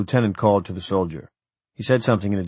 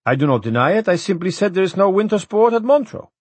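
high, louder, stubborn rebuttal; stubborn official; 11 semitones higher, 5 dB louder than usual. A narrator's reading. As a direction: stand your ground, voice pitched up.

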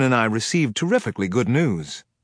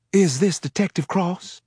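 higher, hesitant Southern woman; a frightened woman with a Southern lilt; Southern accent; 8 semitones higher than usual. Acted. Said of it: "with a heavy Southern accent".

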